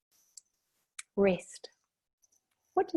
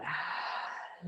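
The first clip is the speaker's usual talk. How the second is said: soft, breathy release of air; long sighing exhale; 11 dB quieter than usual. Shown as a demonstration. Meditation leader modelling the out-breath, relaxed sigh. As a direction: let the breath out in an audible sigh.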